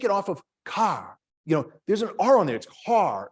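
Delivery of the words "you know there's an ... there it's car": "car" said with the R, as southerners insist; Boston car. Shown as a demonstration. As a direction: Georgia Tech colleagues correct the Boston professor's "car". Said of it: "there's no R on data or idea".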